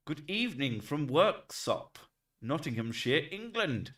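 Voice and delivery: high, slowed Nottinghamshire greeting; mock Nottinghamshire accent. Streamer voicing a viewer's greeting from Worksop, mock Nottinghamshire accent. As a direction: put on a hammed-up Nottinghamshire voice. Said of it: "the way you're speaking that".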